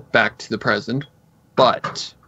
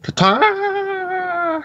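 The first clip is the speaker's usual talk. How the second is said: sing-song ta-da. Pretend.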